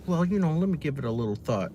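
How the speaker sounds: high, quick, mock-thoughtful reply; imitating a well-spoken interviewee; mock educated answer. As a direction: put on a fake polite, considered tone. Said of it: "answer in a very, like, um, you know, educated way".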